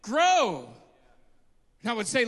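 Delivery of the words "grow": loud, high preacher cry; preaching at full force. Acted. Preacher urging the church to go out, shouting. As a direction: thunder it to the congregation.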